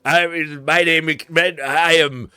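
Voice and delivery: grand, welcoming introduction; the judge. Acted. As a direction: Tarik the merchant greets them grandly. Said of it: "The judge is like".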